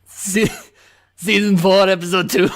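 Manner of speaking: loud, botched character accent; the Hound. Shown as a demonstration. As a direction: announce it in a bad accent drifting toward Arnold Schwarzenegger. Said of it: "I could try the Hound".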